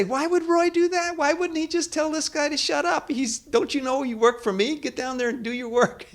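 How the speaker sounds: high, mock-bossy voice in a put-on tone; indignant boss. Imagined boss telling the employee to get back to work, mock indignant. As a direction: play the boss who'd put the worker in his place. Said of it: "And you say".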